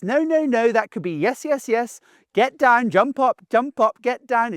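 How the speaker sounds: high, sing-song dog-owner chant; same high tone. Shown as a demonstration. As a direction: say every command in one identical lifted tone. Showing all words sound alike.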